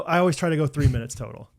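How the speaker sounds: ordinary delivery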